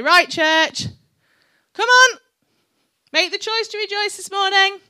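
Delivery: loud, high Birmingham call to the congregation; rousing Birmingham-accented holler; Birmingham accent rallying cry. Retold or imitated. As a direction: shout it like a Birmingham worship leader. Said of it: "in a Birmingham accent".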